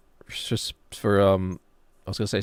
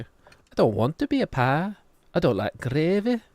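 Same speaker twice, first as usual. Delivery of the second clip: higher, slow, hammed-up Dorset accent; 6 semitones higher than usual. Streamer trying a Chicken Run line, higher Dorset accent. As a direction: imitate a Chicken Run character's Dorset lilt. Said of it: "The Dorset one is, um, from Chicken Run".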